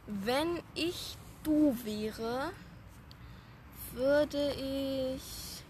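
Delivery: very slow, suspenseful lead-in; hesitant, long-held words; drawn-out game prompt. Pretend. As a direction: stretch each word out before the dare. Slow formula while thinking up dare.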